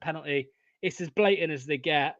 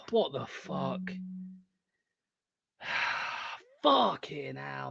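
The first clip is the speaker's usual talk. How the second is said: ordinary delivery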